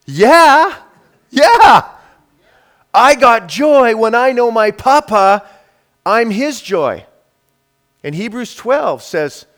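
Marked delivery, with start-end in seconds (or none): 0.0-7.0 loud, high, jubilant shouting; exultant joy. Real, not acted. Preacher answering how God's delight feels, exultant shout. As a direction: cheer it out to the whole room.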